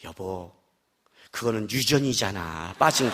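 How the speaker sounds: low, soft, slow teasing reassurance; smiling wife. Retold or imitated. Wife holding her husband's hand, smiling and gentle. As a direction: coo it gently with a smile. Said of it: "씩 웃으면서 손을 잡으면서".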